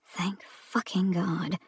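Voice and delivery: slow unvoiced whisper; relieved whisper. Driver muttering relief to herself, whispered. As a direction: breathe it out in relief. Eve's relieved inner thought.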